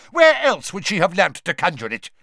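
loud, high furious shout. A narrator's reading. Mister Crouch defending his elf, shouting. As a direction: shout him down. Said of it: "shouted Mister Crouch".

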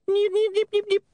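very high squeaky nipping; recording stuttering; high imp glitch chatter; imp skipping noise. A narrator's reading.

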